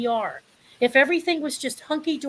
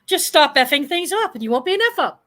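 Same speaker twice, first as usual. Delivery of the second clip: emphatic, higher-pitched scolding challenge; passionate; 5 semitones higher, 5 dB louder than usual. Real, not acted. Speaker challenging self-pitying people to stop messing up, passionate. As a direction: hammer it home with passion, louder and higher. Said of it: "I'm just very passionate about that".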